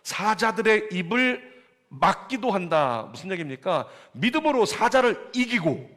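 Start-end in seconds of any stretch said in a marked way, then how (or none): 0.0-3.1 입을 hit high and loud, slow and drawn out; emphatic scripture quote. Shown as a demonstration. Pastor quotes the verse back, 입을 stressed and slow. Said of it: "마지막에 뭐라고 써 있어요?". 4.1-5.9 high preaching emphasis. Acted.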